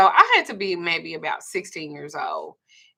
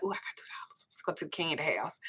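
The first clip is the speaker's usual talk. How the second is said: whispered quote; 11 dB quieter than usual. Retold or imitated. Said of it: "And he'd be like".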